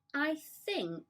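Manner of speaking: high, drawn-out start; slow. A narrator's reading. James starting a careful suggestion, slowly. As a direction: weigh each word slowly. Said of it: "James said slowly".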